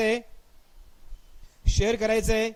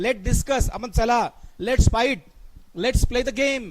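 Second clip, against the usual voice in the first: louder, punched examples; emphatic; 4 dB louder, much slower than usual. Shown as a demonstration. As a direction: call it out with force, louder. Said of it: "जोर देऊन बोलण्यासाठी". (जोर देऊन, मोठ्याने)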